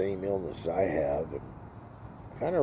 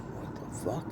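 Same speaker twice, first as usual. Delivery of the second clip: hushed disbelief; 4 semitones higher, 13 dB quieter than usual. Retold or imitated. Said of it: "I'm like".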